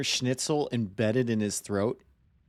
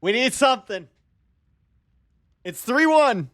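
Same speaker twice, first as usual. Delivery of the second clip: higher and louder, building urgency; urging, excited; 10 semitones higher, 4 dB louder than usual. Real, not acted. Streamer cheering during a close game, rising excitement. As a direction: push the team on, getting tense.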